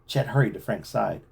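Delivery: plain reading voice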